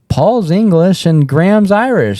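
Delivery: mild mock plea to the crowd; eager pitch. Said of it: "I'm like".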